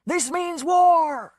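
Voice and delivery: put-on a slow drunken battle cry; drunken shout. A narrator's reading. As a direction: stretch every word into a bellow.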